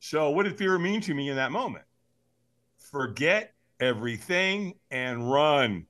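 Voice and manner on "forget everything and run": word-by-word delivery with pauses; slow, emphatic. Real, not acted. Speaker spelling out what fear meant, slowly and emphatically. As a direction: let "Forget", "everything" and "run" each land separately.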